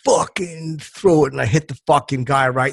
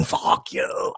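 mock New York snarl; 2 semitones lower, 8 dB quieter than usual. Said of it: "everyone's going".

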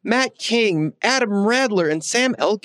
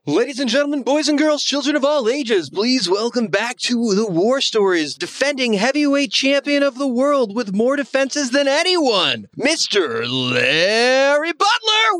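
ringmaster; 8 semitones higher than usual. Acted. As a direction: boom it out like a boxing announcer. Boxing ring announcer introduction.

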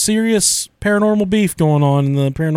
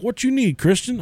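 higher-pitched imitation of a grandpa; lightly voiced grandpa; 8 semitones higher than usual. Grandpa called in to grandson on toilet, lightly imitated. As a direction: lightly play the grandpa answering a call. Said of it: "when the grandpa comes into the bathroom".

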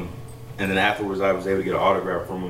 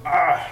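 excited squeal; 9 semitones higher, 4 dB louder than usual. Retold or imitated. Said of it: "and I was like".

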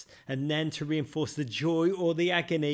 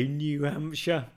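normal talking voice

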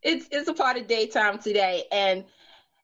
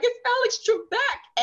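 much higher starstruck gush; excited squeal. Retold or imitated. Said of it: "she was like".

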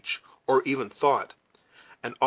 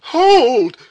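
very loud, high roar of agony; anguished shouted halt; agonized shout; 13 semitones higher, 15 dB louder than usual. A narrator's reading. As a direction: bellow it as an anguished father. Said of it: "shouted the former speaker in the awful tones of paternal agony".

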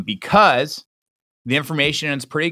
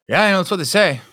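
Dean impression; 5 semitones higher than usual. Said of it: "Dean would say".

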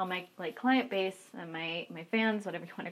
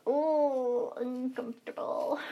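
high, drawn-out cringe; retold sing-song unease; awkward cringe; 5 semitones higher, much slower than usual. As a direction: stretch it out slowly, squirming with discomfort. Retold awkward reaction to client.

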